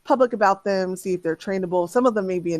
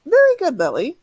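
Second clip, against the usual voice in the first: excited praise; 8 semitones higher, 5 dB louder than usual. Acted. Simic praising Lily's progress.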